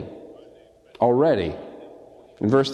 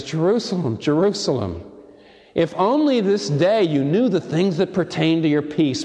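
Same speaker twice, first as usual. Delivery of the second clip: weeping lament; 5 semitones higher than usual. Retold or imitated. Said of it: "he begins to weep".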